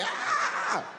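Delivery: very high cry; shrill mock scream. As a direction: yelp in disgust. Victim hit.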